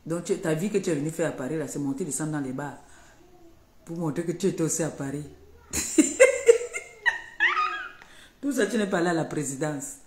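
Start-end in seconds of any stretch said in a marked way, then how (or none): 5.7-8.2 shrieking high laugh bursting out; real mocking laugh, high and loud; loud high cackle. Real, not acted. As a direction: throw back a loud piercing cackle. Bursts out laughing mid-rant.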